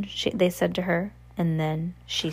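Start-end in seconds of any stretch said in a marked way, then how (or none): none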